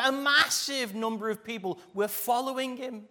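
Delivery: rising, loud, fervent preaching. Acted. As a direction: preach it out with full force. Preaching builds in force.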